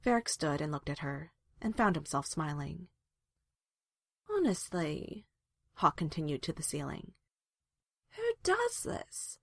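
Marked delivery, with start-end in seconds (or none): 4.3-5.3 a higher, groggy complaint; sleepy mumble. A narrator's reading. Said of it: "She was mush-mouthed with sleep". 8.1-9.4 very high, drawn-out groggy complaint; sleepy, mush-mouthed whine. A narrator's reading. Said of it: "She was mush-mouthed with sleep".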